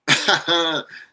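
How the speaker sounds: high-pitched chuckle; teasing laugh. Real, not acted. Host teasing Packers fans, amused laugh. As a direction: laugh at the rival's misfortune.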